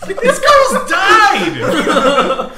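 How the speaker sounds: high, loud, laughing disbelief; incredulous shout. Real, not acted. Outraged at ghost tour story.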